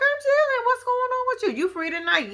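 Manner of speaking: mock flirty woman. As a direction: squeak it out like she's batting her lashes.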